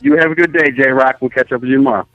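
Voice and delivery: ordinary talk, nothing marked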